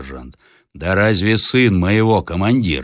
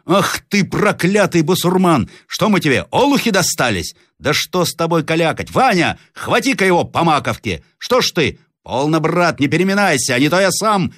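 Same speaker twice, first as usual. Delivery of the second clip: high-pitched, scolding peasant outburst; angry rough peasant; 11 semitones higher than usual. Acted. Red-haired peasant cursing captive and prodding Vanya, angry role voice. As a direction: curse him, then goad your mate.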